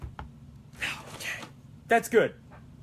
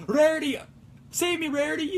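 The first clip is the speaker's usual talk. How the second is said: cartoon pony cry; 10 semitones higher than usual. Quoting a pony cartoon character.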